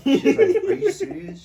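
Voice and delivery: high, loud, laughing question; cracking up while voicing mom. Real, not acted. Laughing while quoting his mom's disbelief to friends.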